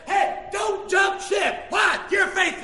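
loud, high, slow exhortation; preaching shout. Real, not acted. Preacher urging a wronged spouse to hold on, preaching shout. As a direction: punch out each word from the pulpit.

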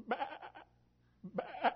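badly faked high sheep bleat; high mock baa. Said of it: "Well, that was bad".

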